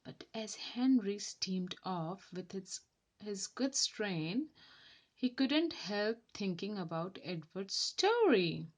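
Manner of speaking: ordinary delivery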